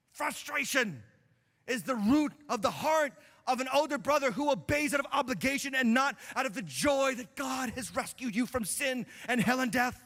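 ordinary delivery

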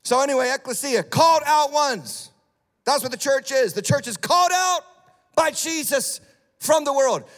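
loud, high-pitched fervent preaching; preaching at full force. Acted. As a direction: preach it to the congregation with fire.

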